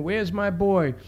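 high-pitched cry without real volume; imitated mother's scream. Mother searching for her son, mild imitated scream. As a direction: call out as a frantic mother, restrained. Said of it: "She's screaming".

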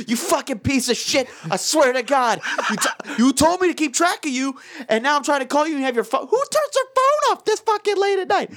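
high-pitched mock-furious voicemail rant; ranting in retold outrage; retold angry rant. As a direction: build to a squawked, exasperated peak. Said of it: "getting more and more angry".

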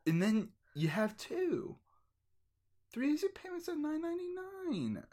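high sing-song sales pitch; infomercial announcer. Pretend. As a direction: sell it like a cheesy ad.

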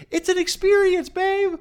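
very high, loud squawk; mock indignant yell. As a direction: shout it way up high, playfully over the top. Acted retort to Macy.

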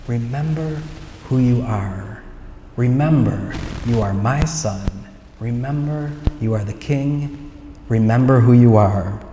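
soft, spaced-out ghostly father, imitated; solemn father's voice. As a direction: intone it gently, pausing between phrases. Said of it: "he says to Simba".

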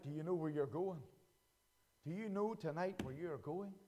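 low and soft, searching; hushed. Acted. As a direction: ask it gently and quietly. God's voice, softened.

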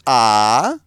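slow Balmerese "Ah", held long; Baltimore vowel demo. Shown as a demonstration. Comedian teaching the hosts Balmerese vowels, Baltimore accent. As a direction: stretch out the Baltimore "Ah". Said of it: "the Balmerese vowels".

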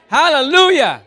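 loud high praise; shouted hallelujah. Real, not acted. Preacher rejoicing before the church, shouted praise. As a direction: lift it up with a shout.

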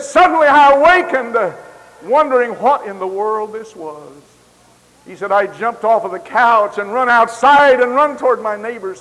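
very loud, high preacher's cry; preaching at full force. Acted. Preacher crying out the dream's waking at full force. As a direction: shout out the awakening.

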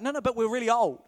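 no, no, push back loud; protesting old man. Retold or imitated. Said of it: "Zechariah's like".

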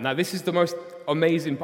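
normal talking voice

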